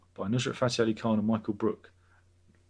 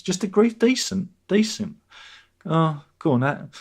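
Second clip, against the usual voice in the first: higher, louder outraged repeat of decent; mock indignation at decent; mock outrage; 7 semitones higher, 6 dB louder than usual. Real, not acted. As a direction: balk at the weak word. Outraged at bland wish.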